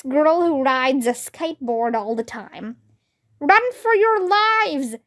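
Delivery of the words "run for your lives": high, slow panicked yell; shouting. A narrator's reading. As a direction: cry out in alarm. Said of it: "shouted Neil".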